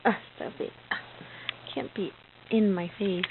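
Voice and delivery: soft whispered coo. Real, not acted. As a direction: breathe her name softly. Cooing to baby.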